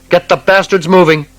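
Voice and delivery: officer losing control, shouting at subordinates; furious shout. A narrator's reading. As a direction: scream the order over the chaos. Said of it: "Anton shouted".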